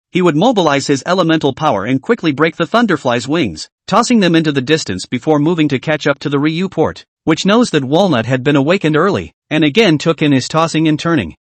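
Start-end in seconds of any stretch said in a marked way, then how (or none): none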